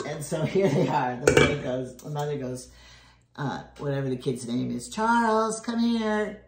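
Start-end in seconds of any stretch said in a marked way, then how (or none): 4.9-6.4 calling out. Retold or imitated. Mom calling the kid over.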